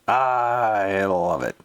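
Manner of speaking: drawn out slowly; savoring. Real, not acted. Delighted reaction to the rant, slowly drawn out. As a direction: stretch every word out.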